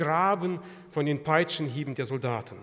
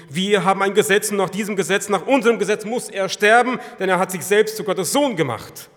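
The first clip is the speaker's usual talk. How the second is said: loud, raised, insistent charge; vehement accusation; 5 semitones higher, 12 dB louder than usual. Acted. Accusers insist to Pilate on their law, vehement. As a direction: press the charge at full preaching force.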